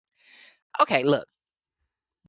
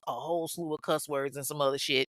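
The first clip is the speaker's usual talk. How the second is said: normal talking voice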